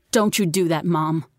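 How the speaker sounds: ordinary delivery, nothing marked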